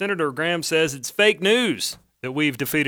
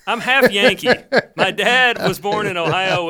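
higher and louder, laughing through it; 5 semitones higher, 7 dB louder than usual. Real, not acted. Cohost laughs while explaining his accent, laughing. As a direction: laugh while defending yourself.

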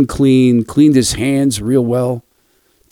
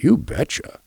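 imitating grandpa; 8 semitones higher, 4 dB quieter than usual. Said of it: "The tone kept changing".

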